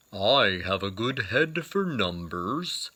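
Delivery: slow, lightly voiced bear; a touch of friendly bear, taken slowly; gently voiced bear offering help.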